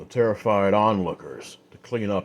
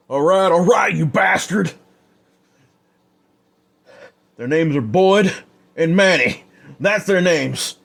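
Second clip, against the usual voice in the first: much higher and louder, strained pleading; pained, desperate man; 9 semitones higher, 6 dB louder than usual. Acted. Stabbed outlaw giving up his friends, desperate strained yelling. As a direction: blurt the names through pain. Flynn gives up names in pain.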